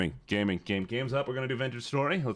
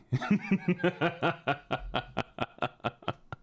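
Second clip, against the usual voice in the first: long breaking-up laugh; drawn-out giggle; 4 semitones higher than usual. Real, not acted. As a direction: laugh on and on in short pulses. Laughing after retort.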